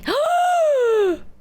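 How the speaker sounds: high, loud shocked gasp; sharp gasp. Real, not acted. On hearing the secret third thing, loud high gasp.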